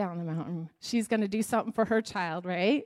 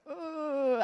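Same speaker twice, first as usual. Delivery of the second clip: higher, drawn-out dreading "oh"; mock reluctance; 6 semitones higher than usual. Dreading someone's whole life story, mock reluctant. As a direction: groan it out as if cornered. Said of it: "And we're like".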